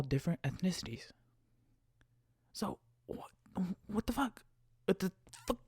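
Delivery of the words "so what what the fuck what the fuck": hushed, higher disbelief; baffled whisper. Retold or imitated. Driver baffled at being accused by passenger, baffled whisper. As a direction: whisper it, at a loss.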